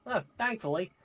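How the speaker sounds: high, loud New York tough guy; New York accent Gavin. Acted. Gavin in a crude New York accent talking to the adventurer. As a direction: swagger through it, New York style. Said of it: "give him the New York".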